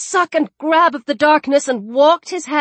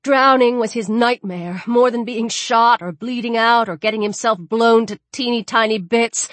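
ordinary delivery, nothing marked

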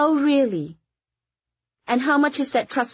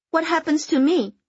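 higher, pressing demand; 6 semitones higher than usual. Acted. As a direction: insist on an answer. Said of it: "Kitty demanded".

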